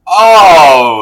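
loud high oh of sympathy; pained gasp. Real, not acted. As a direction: wince out loud at it. Reacting to missed last beat.

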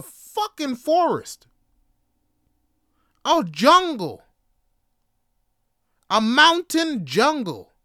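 loud, high, drawn-out disbelief; amazed exclamation. Real, not acted. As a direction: hammer it home in astonishment. Amazed exclamation at mother's walk.

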